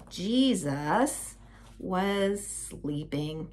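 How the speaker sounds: soft, very slow and drawn out; slow, hushed storytelling; hushed reveal. A narrator's reading. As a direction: tell it as if not to wake him. Hush for the sleeping Jesus.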